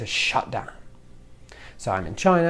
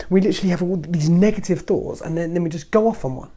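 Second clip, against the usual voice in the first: ordinary talk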